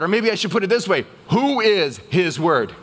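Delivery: ordinary talk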